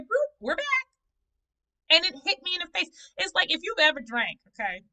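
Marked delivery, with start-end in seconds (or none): 0.0-0.9 high, bright pop-in greeting, far above her usual voice; a trauma cheekily announcing its return; playful mock voice. Trauma showing up again, in a high playful mock voice. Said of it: "it showed up and said".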